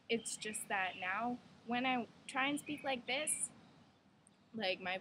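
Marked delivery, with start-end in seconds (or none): 1.7-3.5 demonstrating the higher pre-transition voice, pitched up; old higher voice. Shown as a demonstration. Showing viewers the voice from before, pitched higher. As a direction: push the voice up to how it used to sound. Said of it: "which is how I probably was speaking before".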